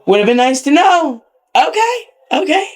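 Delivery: high-pitched sarcastic acceptance; put-out and high, conceding sarcastically; indignant retort. Retold or imitated. Acted indignant reply to DJ.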